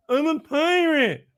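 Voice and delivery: put-on high, slow nasal-lisped kid voice; kid with a cleft lip. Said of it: "he kinda talks like this".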